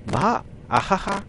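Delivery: high bright burst of laughter; laughing. Acted. Arisa laughing at the silly abbreviation game. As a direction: laugh it off, delighted.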